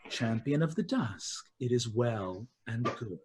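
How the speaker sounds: Queen of Dusk asks Demora to be her champion, solemn. Acted. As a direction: ask it gravely, with dark dignity.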